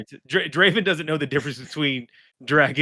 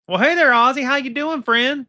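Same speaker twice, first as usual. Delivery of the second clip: friendly greeting; 7 semitones higher than usual. Acted. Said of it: "be like".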